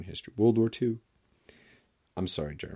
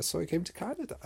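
mock German grandpa; 12 semitones higher, faster than usual. Grandpa impression continues.

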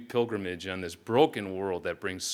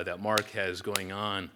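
ordinary delivery

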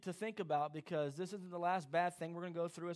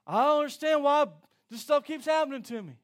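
loud, higher wail of despair; frustrated outcry; 5 semitones higher, 12 dB louder than usual. Acted. Believer in hardship crying out loud in frustration. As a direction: cry out to God in exasperation.

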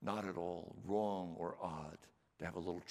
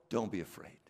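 ordinary delivery

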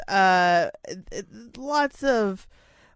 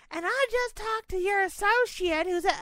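high mock customer voice; 12 semitones higher than usual. Imagined customer complaining.

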